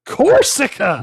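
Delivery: loud, high burst of recall; sudden recognition. Real, not acted. Friend finally hears the forgotten car name, excited recognition. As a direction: blurt it as it clicks.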